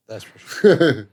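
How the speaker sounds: slow drawn-out repeat. Shown as a demonstration. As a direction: stretch it out slowly, teasing. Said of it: "repeated in a different way".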